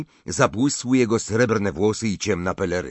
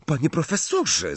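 higher, urgent protest; 7 semitones higher than usual. A narrator's reading. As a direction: cry out to stop him. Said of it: "zaprotestował Harry".

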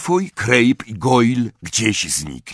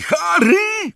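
very high, shrieked name; cried out. A narrator's reading. Hermiona crying out to Harry. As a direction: scream the name in warning. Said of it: "krzyknęła".

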